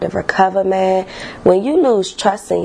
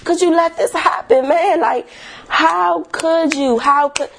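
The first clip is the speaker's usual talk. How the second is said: high, exasperated self-scolding; scolding herself; 7 semitones higher than usual. Retold or imitated. Reliving scolding herself after the breakup, exasperated. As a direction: berate yourself, pitch up. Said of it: "I was mad at myself".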